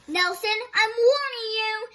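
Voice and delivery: loud, high threat; warning. Acted. Thorndyke warning Nelson off, loud and high. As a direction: shout the warning.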